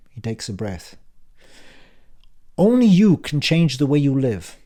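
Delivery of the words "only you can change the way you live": put-on louder, higher, impatient old man; brusque uncle Frank. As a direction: bark it like a testy uncle back from the dead. Frank's parting advice.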